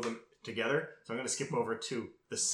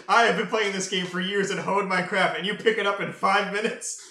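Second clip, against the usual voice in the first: higher, louder mock indignation; indignant; 5 semitones higher, 5 dB louder than usual. Darby, who honed his craft for years, indignant. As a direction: protest loudly in acted disbelief.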